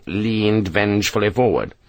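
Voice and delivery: ordinary delivery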